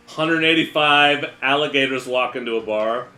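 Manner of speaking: ordinary delivery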